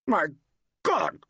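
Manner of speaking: high, choked exclamation; sudden sickened gasp of words. A narrator's reading. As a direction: choke it out in two pieces. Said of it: "choked George Mason suddenly".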